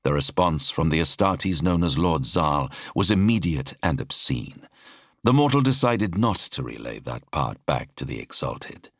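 usual speaking voice

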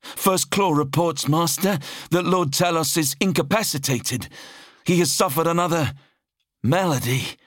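high-pitched subordinate's report; high, strained-up servant voice; anxious officer reporting. A narrator's reading. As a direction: report to a feared master, voice pitched right up. Fearful officer relays bad news.

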